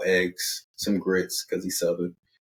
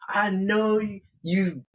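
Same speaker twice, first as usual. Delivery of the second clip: high-pitched, scolding interrogation; acted jealous tirade, voice pitched way up; irate girlfriend; 8 semitones higher than usual. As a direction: grill him in a high, indignant voice. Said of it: "his girlfriend is irate".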